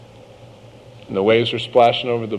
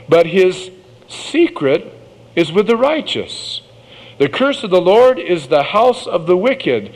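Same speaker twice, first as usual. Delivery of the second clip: scripture reading; 6 semitones higher than usual. Acted. Reads Proverbs aloud.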